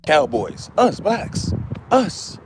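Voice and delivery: ordinary talk, nothing marked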